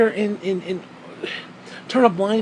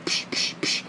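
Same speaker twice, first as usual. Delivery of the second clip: mock smack sounds; 9 dB quieter than usual. Mimed Bible smacks.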